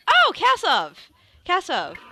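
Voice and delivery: high, loud delighted greeting; eager, high-pitched hail; very enthusiastic. Acted. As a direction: call out to an acquaintance, thrilled. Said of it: "very enthusiastic".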